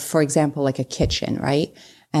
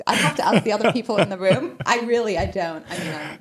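laughing; 4 semitones higher than usual. Real, not acted. Laughing about no deodorant.

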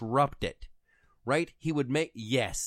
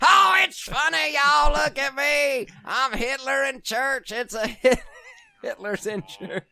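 Hitler rant voice; 8 dB louder than usual. Acted. Mocking a ranting Hitler voice.

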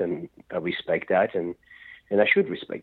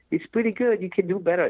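mock courteous; 12 semitones higher than usual. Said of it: "he could have been courteous and said".

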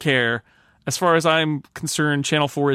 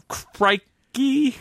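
mock British exclamation; 11 semitones higher than usual. Shown as a demonstration. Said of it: "I don't know what they would say".

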